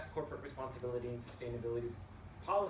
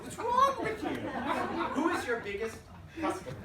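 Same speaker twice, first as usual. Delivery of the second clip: much higher, louder shriek of disbelief; shocked shout; 10 semitones higher, 7 dB louder than usual. Retold or imitated. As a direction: shriek at the CEO in disbelief, laughing. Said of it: "I looked at him and I said".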